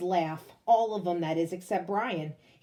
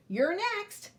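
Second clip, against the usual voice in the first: calling out; 10 semitones higher than usual. Acted. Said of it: "Emilio calls out".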